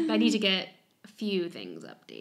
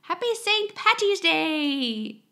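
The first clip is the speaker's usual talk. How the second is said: put-on Irish accent; 7 semitones higher, much slower than usual. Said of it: "I'm trying to say it with an Irish accent".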